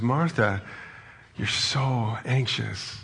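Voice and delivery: gentle, slow and soft, naming her worry without blame; tender Jesus. Retold or imitated. Jesus gently naming anxious Martha's worry, tender and slow. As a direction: say it slowly, like a caring friend. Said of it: "the tone is so different here".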